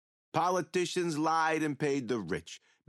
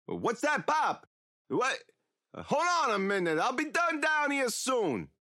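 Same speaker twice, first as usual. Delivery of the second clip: calling upstairs; 8 semitones higher than usual. Real, not acted. Killer interrupted, calls up to his hard-of-hearing pop.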